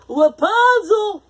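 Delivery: high, loud, slow calling shout. A narrator's reading. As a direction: call out her name. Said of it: "he shouted".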